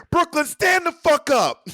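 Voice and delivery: high, slow, loud arena yell; stage hype shout at Barclays. Retold or imitated. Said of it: "it was very fun to yell".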